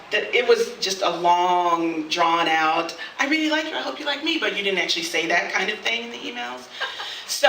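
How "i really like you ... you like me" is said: high, sing-song imitation of a crush; mock coy confession. Unspoken subtext of long emails, mock coy. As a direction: lift it into a coy little voice.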